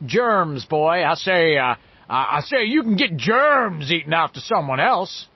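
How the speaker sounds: loud, swooping Southern gentleman bluster; Foghorn Leghorn. A narrator's reading. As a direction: roll it out grandly like a Southern cartoon orator. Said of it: "in his grandly rolling Southern gentleman voice".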